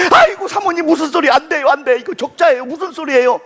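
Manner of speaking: begging her not to ask that; mock desperate seller; pleading seller.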